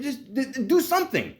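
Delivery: high, stammering exasperated plea. Retold or imitated. Patient begs the doctor to act, exasperated.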